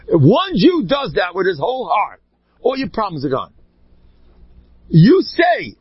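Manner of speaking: ordinary talk, nothing marked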